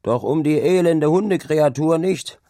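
rough shout. A narrator's reading. Worker scorning the stop for a wretched dog, rough shout. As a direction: shout up in contempt. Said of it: "schrie eine raue Stimme von unten herauf".

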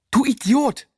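high, loud furious scream. Acted. Boy screams at Mike after the shot, furious. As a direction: scream it at him. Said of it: "schrie ich".

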